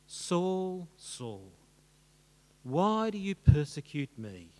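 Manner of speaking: lightly voiced, weighty divine address; slow, solemn call from heaven, lightly acted; voice of Jesus. Jesus confronting Saul on the road, solemn divine voice. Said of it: "what Jesus says to him".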